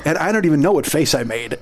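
ordinary talk, nothing marked

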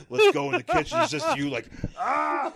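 hammed-up gleeful shriek; mock manic glee. Joking host to co-host, a giddy high squeal. Joking about tenderizing a person.

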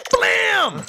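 loud high electronic blam; robot blast. Shown as a demonstration. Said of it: "if I stick it, the L E D light into the nose".

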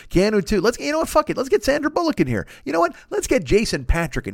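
high, excited casting-boss voice; mock producer voice. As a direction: squeal it like a giddy producer picking stars.

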